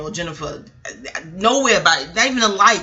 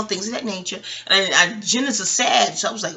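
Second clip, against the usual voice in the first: usual speaking voice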